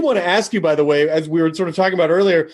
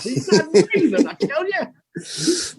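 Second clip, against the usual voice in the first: put-on old prospector voice; 9 semitones higher, slower than usual. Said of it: "hold a mirror up to his mouth".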